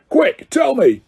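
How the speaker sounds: gruff caretaker. Acted. Filch demanding answers.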